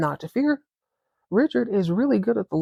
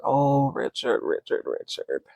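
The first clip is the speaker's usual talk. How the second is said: heartbroken sigh; 5 semitones lower, 3 dB quieter, slower than usual. Retold or imitated. Vlogger mourning Richard's TV blunder, a low dismayed groan. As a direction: lower and softer, Richard's name drawn out in dismay. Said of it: "My heart literally dropped".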